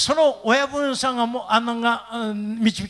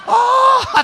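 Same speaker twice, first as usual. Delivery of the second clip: high, loud reenacted "aah"; mock cry of being swallowed up; drawn-out cry; 9 dB louder than usual. Sinking into the deep sofa.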